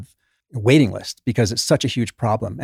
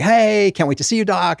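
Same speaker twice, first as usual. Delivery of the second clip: sing-song greeting pitched much higher; super friendly patient; 9 semitones higher, 3 dB louder than usual. Retold or imitated. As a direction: gush a bright hello like a charming patient. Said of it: "She's super friendly".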